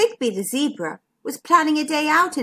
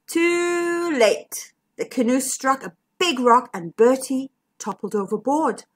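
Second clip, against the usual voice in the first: ordinary delivery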